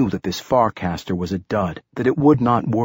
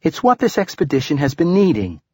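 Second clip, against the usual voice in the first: put-on high-pitched, excited girl's voice; animated, higher girl character; excited cry; 7 semitones higher, 4 dB louder than usual. A narrator's reading. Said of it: "cried Aeneas".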